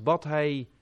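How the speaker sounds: ordinary talk, nothing marked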